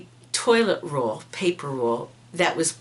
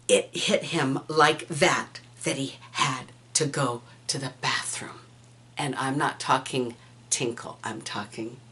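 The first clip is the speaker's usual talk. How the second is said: whispered aside; 7 semitones lower, 7 dB quieter than usual. Real, not acted. Telling a gross secret.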